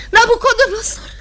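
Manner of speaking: high calling shout into emptiness; shouting a name. Acted. Woman calling her lost brother, shouting high.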